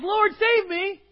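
high, loud cry for help; desperate cry. Acted. Preacher voicing a drowning believer, crying loudly. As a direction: plead as if drowning.

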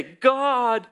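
breathless, pleading call out loud; desperate plea. Retold or imitated. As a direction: cry out to God at last.

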